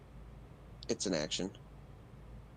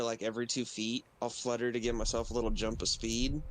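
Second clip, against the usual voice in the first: ordinary talk, nothing marked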